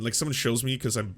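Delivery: ordinary delivery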